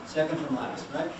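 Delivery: ordinary talk, nothing marked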